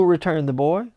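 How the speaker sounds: usual speaking voice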